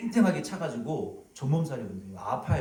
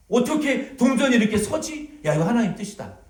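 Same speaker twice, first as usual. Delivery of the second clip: mock believer; 8 semitones higher, 6 dB louder than usual. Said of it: "이건 신앙이 아니라고요".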